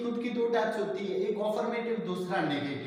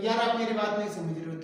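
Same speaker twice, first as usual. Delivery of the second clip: normal talking voice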